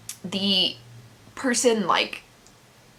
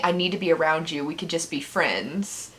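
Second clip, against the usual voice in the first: ordinary talk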